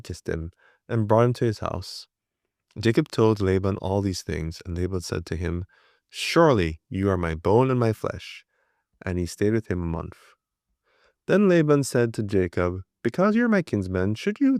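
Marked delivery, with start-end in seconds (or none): none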